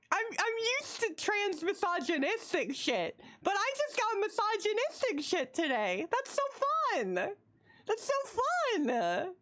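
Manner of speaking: high, excited rant; high giddy disbelief. Real, not acted. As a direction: ride the laughter into a high rant.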